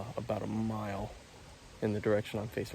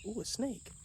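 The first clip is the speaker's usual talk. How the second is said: surprised; 9 semitones higher than usual. Real, not acted. Spots a snake suddenly.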